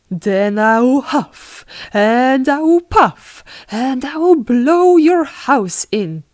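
threatening wolf. Acted. Wolf warning the pig he will blow, threatening. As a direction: build the threat to a loud, high peak.

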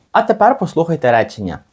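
ordinary talk

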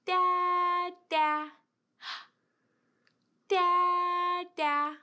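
loud, drawn-out call. Shown as a demonstration. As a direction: call out for dada. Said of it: "Let's call the dada".

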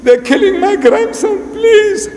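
high-pitched acted begging; desperate plea. Prophet begging Allah to save his grandson, pleading.

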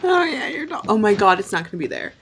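ordinary talk, nothing marked

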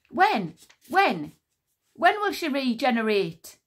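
loud, urgent tumbling questions; gabbled. Acted. Queen Sycorax desperate for her daughter, loud gabbling. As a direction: rush the words out. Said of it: "gabbled Sycorax".

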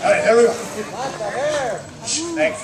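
normal talking voice

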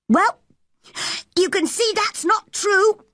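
put-on high, emphatic boy's voice; triumphant boy. A narrator's reading. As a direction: declare it as a boy proving them wrong. Boy triumphantly refutes the rumour.

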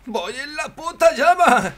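loud, high-pitched drawn-out yell; full-voiced gamer shout; excited yell. Real, not acted. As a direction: yell it out high and slow over the action. Yelling mid-game while on fire.

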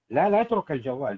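normal talking voice

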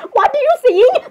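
high-pitched incredulous question; hammed-up disbelief, pitch leaping up; mock alarm. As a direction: demand it in play-acted shock. Other character reacts to plea.

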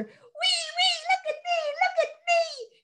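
put-on very high, slow, excited child's whee; a boy showing off on the swing; gleeful whee. A narrator's reading. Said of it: "he said".

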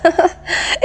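a quiet, breathy little laugh; soft teasing giggle. A narrator's reading. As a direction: laugh softly at her sister. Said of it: "وهي تضحك".

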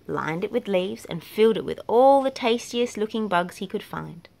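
usual speaking voice

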